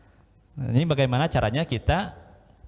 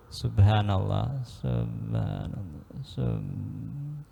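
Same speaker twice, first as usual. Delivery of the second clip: slow, sleepy, fading into silence; drowsy fading zikir; 6 semitones lower, 6 dB quieter, much slower than usual. Retold or imitated. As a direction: drift off mid-prayer, slower and softer. Zikir mengantuk sampai tertidur. (zikir mengantuk yang melambat dan memudar sampai tertidur)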